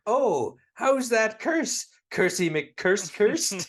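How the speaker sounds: very high, loud, slow hammed-up fortune teller; mock fortune teller. Fortune teller teasing Homer about his curse, hammed-up high voice. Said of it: "the fortune teller says to him".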